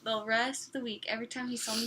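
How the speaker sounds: ordinary delivery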